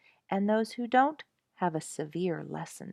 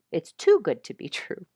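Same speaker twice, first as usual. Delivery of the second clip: laughs mid-phrase, louder; a real chuckle at own slip; laughing; 8 dB louder than usual. Real, not acted. Narrator correcting a slip, laughing through it.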